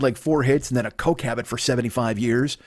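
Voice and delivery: usual speaking voice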